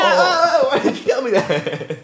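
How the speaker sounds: high, loud wail breaking into laughter; mock horrified outburst. Retold reaction to what happened on the couch, hammed-up horror. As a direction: cry out in exaggerated dismay.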